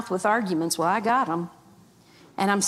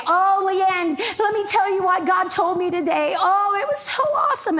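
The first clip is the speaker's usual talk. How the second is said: high, bright mock gushing voice, imitating the roommates; 8 semitones higher than usual. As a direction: lay it on thick, a sugary put-on rave. Roommates gushing about God's words.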